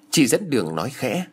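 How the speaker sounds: ordinary delivery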